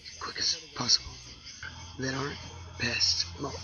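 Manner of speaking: hushed, grave whispering throughout; somber whisper. Acted. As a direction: whisper it like a solemn warning. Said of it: "This is a somber public service announcement".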